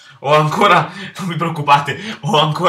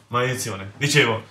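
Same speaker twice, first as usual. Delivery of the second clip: ordinary talk, nothing marked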